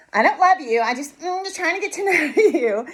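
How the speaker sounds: high-pitched mock denial; pretending not to love. Dater to a new partner, mock indifference. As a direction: put on coy disinterest, breaking into a laugh. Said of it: "you have to act like you don't love people".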